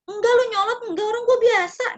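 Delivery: high, loud back-and-forth bickering; two friends squabbling over tone, loud and high; mock quarrel. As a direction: argue both sides of a petty spat. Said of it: "pertentangan tuh".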